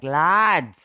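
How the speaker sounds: loud exasperated "Lads". Retold or imitated. Reacting to quiz team, a loud exasperated call. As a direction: call out in disbelief. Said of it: "I was like".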